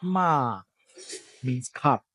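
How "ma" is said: "ma" held long on a level mid pitch; a long, unbent "ma"; flat mid tone. Shown as a demonstration. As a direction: drag out "ma" evenly. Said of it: "flat and dragged out".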